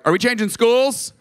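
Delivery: put-on high-pitched kid asking; lightly acted kid voice, pitched high; child's question. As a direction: imitate a child piping up with a question. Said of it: "one of them was like".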